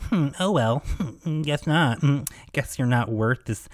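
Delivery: high, sing-song dismissive hums, imitating; mock the reporter's brush-off with lazy hums; mocking condescension. Mocking Sarah's condescending brush-off, high and dismissive. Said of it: "she talked down to me".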